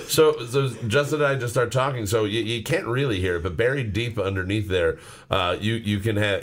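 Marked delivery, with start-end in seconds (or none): none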